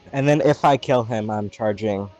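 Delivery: ordinary talk, nothing marked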